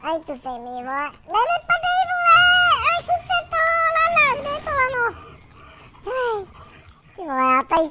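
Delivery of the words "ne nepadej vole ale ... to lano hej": shrill panic as the character slips, much higher; panicked high yell. Real, not acted. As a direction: yell in alarm, voice jumping up. Character falling off cliff. (vyděšený vysoký výkřik, když postava padá)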